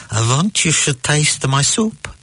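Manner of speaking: put-on joke-teller's pleading elderly customer; old man. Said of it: "An old man is in a restaurant".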